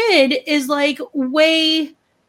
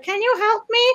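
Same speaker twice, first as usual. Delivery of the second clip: lightly voiced high plea; imitated plea for help; pleading stranger; 9 semitones higher than usual. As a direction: ask higher, like someone wanting help. Mimics people asking for help.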